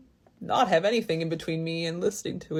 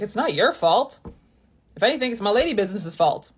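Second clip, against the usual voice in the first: joking protest; 5 semitones higher, 6 dB louder than usual. Retold or imitated. Joking reply, blaming own body.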